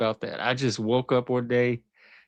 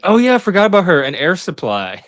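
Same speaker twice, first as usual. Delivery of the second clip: sudden excitement; 8 semitones higher, 7 dB louder than usual. Real, not acted.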